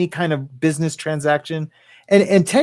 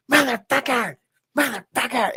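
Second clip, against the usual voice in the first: retold cursing; 7 semitones higher than usual. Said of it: "And he was so mad".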